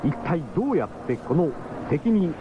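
usual speaking voice

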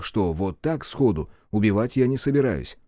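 ordinary delivery, nothing marked

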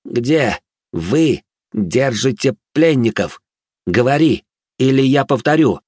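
slow, menacing "Где... вы... держите... пленников", every word stressed separately; words separated. A narrator's reading. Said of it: "разделяя слова, поинтересовался".

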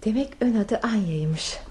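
slow, breathy whisper; a slow hushed aside; whispered musing. Acted. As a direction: murmur the name as if savouring it. Musing over her first name.

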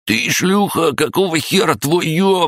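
высокий истошный крик; орёт во всё горло; вопль. Acted. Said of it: "Вопль блондина". (пронзительный вопль)

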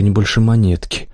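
ordinary delivery, nothing marked

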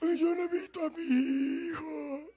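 high, slow tearful whine that breaks into a wailing cry; hammed-up sobbing of a grieving father; weeping over lost years, overplayed; mock crying. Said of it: "el director de la cia llorando".

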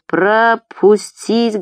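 long-drawn, high commanding call; thunderous command. A narrator's reading. Murat ordering the crowd aside, thundering. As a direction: bellow it slowly, stretching each syllable. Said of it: "громовым голосом орёт Мурат".

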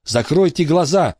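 high, firm command. Acted. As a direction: order them firmly to close their eyes. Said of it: "велела Кейт".

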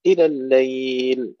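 إلى الليل sung out slowly with the ياء stretched; long chanted stop on الليل; drawn-out recitation. Shown as a demonstration. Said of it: "الوقف على الليل".

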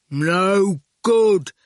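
slightly loud, gloomy character voice; dismal. A narrator's reading. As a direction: pronounce it dismally in a put-on voice. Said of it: "Erskine said dismally".

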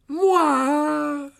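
high, loud indignant creature cry; furious spider exclamation. Acted. Aragog denies living in the Chamber, furious and high. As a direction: fling it back, outraged. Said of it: "s'exclama Aragog dans un cliquetis furieux".

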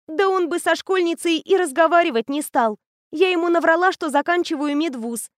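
put-on high, bright-eyed girl raving about a machine; eager teenage girl. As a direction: gush like a know-it-all teen. Anya confessing, high.

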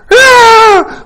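high, loud tantrum scream; mock shriek of an enraged toddler; angry child's scream. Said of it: "Se enoja".

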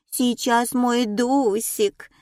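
put-on cooing, slow baby talk. A narrator's reading. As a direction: coo at the little dog. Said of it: "засюсюкала Нина".

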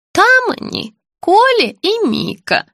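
put-on high, slow, childlike pleading; confused old woman. A narrator's reading. Sick woman begging to see her child, confused high voice. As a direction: plead slowly in a high little voice.

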